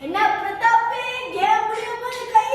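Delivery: high-pitched taunt at a rival; taunting jeer. Acted. Taunting a player about his hand at game's end, jeering. Taunts Prathap during the game.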